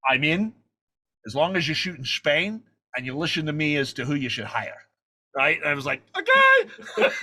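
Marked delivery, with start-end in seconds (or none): 0.1-4.8 commanding Scottish star impression; deliberate, authoritative impersonation; Sean Connery. 6.2-7.1 very high, loud yelp of agreement; comic falsetto eagerness; starstruck squeak. Retold or imitated. Retold starstruck agreement to Connery.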